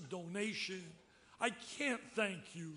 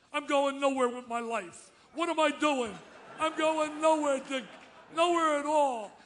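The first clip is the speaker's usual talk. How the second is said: hammed-up loud, higher whining lament; mock moaning George; 5 semitones higher, 8 dB louder than usual. Dick belting George's old lament into an answering machine, loud mock whine. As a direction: overplay the sad-sack cry to the crowd. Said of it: "And I left the message".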